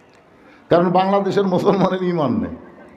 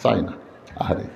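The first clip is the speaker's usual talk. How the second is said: ordinary talk, nothing marked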